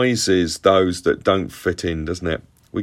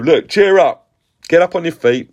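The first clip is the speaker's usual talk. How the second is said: higher, louder rousing voice; lightly acted call to get someone moving; urging call; 9 semitones higher, 5 dB louder than usual. People to the blind man, urging him up.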